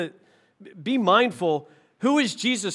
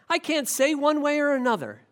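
ordinary delivery

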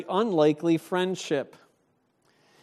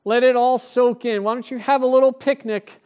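higher, louder, breezy mock offer; a hammed-up laid-back angel, louder and higher; mock casual angel; 6 semitones higher, 6 dB louder than usual. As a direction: play the angel as lazily easygoing. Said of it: "the angel doesn't say".

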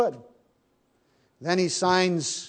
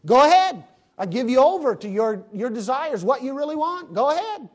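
higher and louder, a scornful go-ahead; loud mock dismissal; 7 semitones higher, 4 dB louder, faster than usual. God to the nation, a loud scornful go-ahead. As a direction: let them go with a raised scornful voice. Preacher voicing God to America.

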